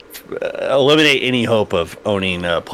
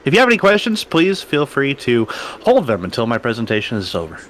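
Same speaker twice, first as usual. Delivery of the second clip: announcer; 5 semitones higher than usual. Acted. In-game presenter addressing players.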